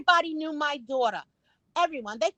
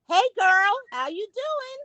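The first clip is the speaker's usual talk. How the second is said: high, sing-out greeting; bright imitated hail, pitched way up; friendly passer-by; 8 semitones higher, slower than usual. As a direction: imitate a neighbour calling hello to the porch. Voices passers-by greeting her porch.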